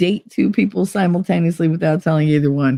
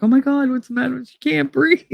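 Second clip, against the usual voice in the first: put-on alarmed boyfriend; 7 semitones higher than usual. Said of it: "he was just like".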